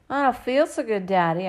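put-on child putting on a sick, rough voice; sandpaper voice. A narrator's reading. Daughter pretending to be ill, putting on sandpaper voice. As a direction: fake sickness to Daddy. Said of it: "making my voice sound like sandpaper".

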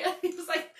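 usual speaking voice

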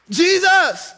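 high, loud pleading call; crying out. Acted. Pastor modelling prayer for the church, crying out. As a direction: cry his name with longing. Climactic preaching cry.

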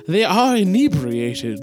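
slow, high tipsy voice; drawn-out, high tipsy line. Acted. Tipsy character.

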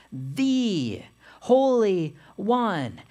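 "the" stretched to "thee", words spaced out; stress on "the". Shown as a demonstration. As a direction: set "the" apart as a long "thee". Repeats the stressed title.

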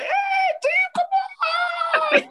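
cheering team call; rallying the team. Pretend. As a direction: fire up the team. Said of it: "You're like".

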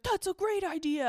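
high-pitched eager dad impression; mock enthusiasm. Imitating Charlie jumping at the trip.

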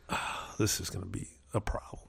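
a sly muttered worry; whispered aside. Retold or imitated. Sizing up the opponent, a quiet whisper to himself. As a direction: whisper it under your breath. Private thought voiced to self.

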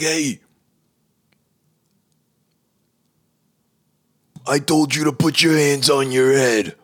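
high, loud throat-straining cop parody; yelling detective. Acted. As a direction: bark orders as the insane-voiced detective. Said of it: "he's yelling".

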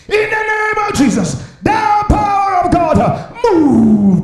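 high, loud declaration, slow; commanding prayer shout. Acted. Pastor mimicking young pastors commanding power, loud declaration. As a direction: call down power like a showman.

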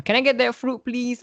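high voiced customer asking. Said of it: "it's like".

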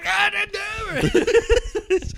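high, loud, drawn-out outburst; angry mock yell. Movie gangster raging at a lost bet, hammed-up angry yell. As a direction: imitate an enraged sports fan's long yell. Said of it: "he just gets mad at games".

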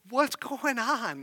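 high, drawn-out sleepy child imitation; little kid voice. Three-year-old just picked up from sleep, imitated kid voice. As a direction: pitch it up and slow it like a puzzled kid. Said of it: "And he's like".

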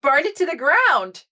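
high, cheery voice for a reckless character; high gleeful character voice; Oddbell's suggestion. Retold or imitated. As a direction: chirp it out brightly as the gleeful one. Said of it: "Oddbell, goes".

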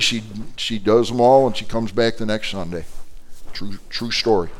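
ordinary delivery

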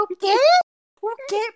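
high, stunned double take; shocked grandma. Retold or imitated. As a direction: play a scandalised grandma gasping. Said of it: "E ela".

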